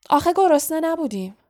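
high, quick, loud retort. A narrator's reading. Ron covering for skipping supper, loud blurt. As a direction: blurt it out loudly. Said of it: "با صدای بلند گفت".

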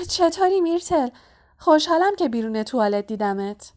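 high-pitched forced cheer; falsely friendly. A narrator's reading. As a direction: greet through a fake smile. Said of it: "با لبخندی تصنعی گفت".

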